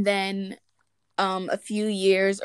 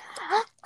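mock gasp; 13 semitones higher than usual. Feigned shock at own joke.

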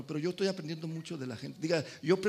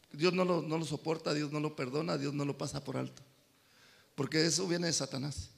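normal talking voice

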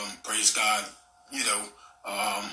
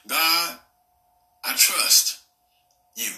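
loud tearful cry to God; fervent cry; 3 semitones higher, 5 dB louder, slower than usual. Acted. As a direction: cry out "I trust you" in earnest prayer. Crying out prayer to God.

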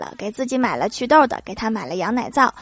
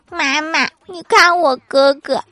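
put-on higher, slow, whiny child's voice; aggrieved little boy; 5 semitones higher, 4 dB louder, slower than usual. Little son complaining to mom about his brother, whiny. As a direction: drag it out like a wronged toddler. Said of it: "小儿子委屈地说".

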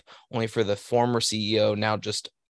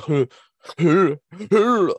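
mock groan; 7 semitones higher, 6 dB louder, much slower than usual. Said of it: "He's like".

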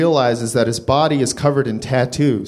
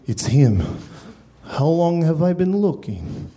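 lightly voiced stunned Leonard. Leonard in shock.

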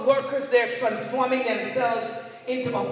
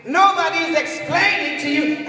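loud, high, emphatic sermon delivery; forceful preaching; 6 semitones higher, 8 dB louder than usual. Real, not acted. Preacher warning a church of deception, forceful preaching. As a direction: preach it out, leaning hard on key words.

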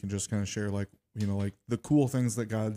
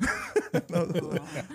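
bright laugh; 9 semitones higher than usual. Real, not acted. Laughing at the funny story.

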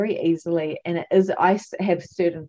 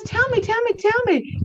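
put-on much higher, louder childlike pleading; impatient nagging; eager begging; 5 dB louder than usual. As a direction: beg like a kid who can't wait. Midwife begging to be told.

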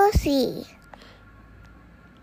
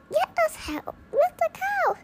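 put-on much higher, eager child voice; high Peter voice; 13 semitones higher than usual. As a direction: squeak it out like little Peter. Child voicing Peter's line.

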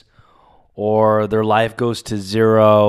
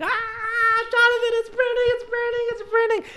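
very high falsetto mock squeal; high, bouncing excited imitation; imitating excited grandma; 3 dB quieter than usual. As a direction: squeal it like an overjoyed grandma, without volume. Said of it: "you could hear her voice jump in the air".

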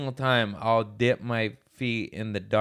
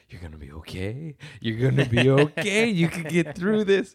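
shaky self-reassurance; 3 semitones higher than usual. Retold or imitated. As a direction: start hushed, build into anxious self-coaching. Psyching self up after puking.

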